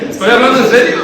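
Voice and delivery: high, loud, laughing protest. Real, not acted. Preacher swearing he's serious while laughing loudly. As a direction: insist you're serious while cracking up.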